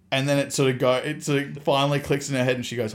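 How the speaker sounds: normal talking voice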